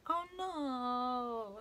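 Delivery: ordinary delivery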